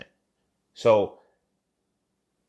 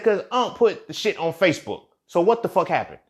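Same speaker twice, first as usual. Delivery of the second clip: shouted much higher and louder, furious; angry outburst; 8 semitones higher, 4 dB louder than usual. Retold or imitated. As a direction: demand the truth from your sister, enraged. Angrily demanding answers from sister.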